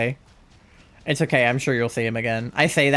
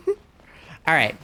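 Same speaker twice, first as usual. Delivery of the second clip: laughing. Real, not acted.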